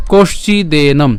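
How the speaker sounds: কশ্চিদেনম slowly as "kosh-chi-de-nom"; slow pronunciation. Shown as a demonstration. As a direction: stretch out each part of কশ্চিদেনম. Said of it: "কষ তালব্য স পর্যন্ত উচ্চারণ করছি". (কশ্চিদেনম ধীরে স্পষ্ট করে)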